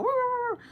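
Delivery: very high woo-woo dog sounds; high-pitched playful dog imitation; imitated dog howl. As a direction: howl like excited little dogs. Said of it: "My dogs go".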